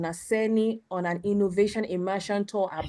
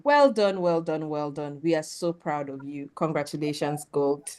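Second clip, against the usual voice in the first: normal talking voice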